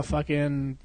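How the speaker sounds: ordinary delivery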